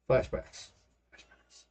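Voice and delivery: breathy ghostly whisper. Pretend. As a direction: whisper it like a haunting memory. Whispered joke flashbacks.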